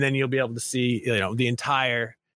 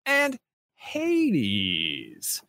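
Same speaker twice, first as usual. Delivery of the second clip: awards announcer; 4 semitones higher than usual. Acted. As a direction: present each title like a gala host. Announcing nominees like awards show.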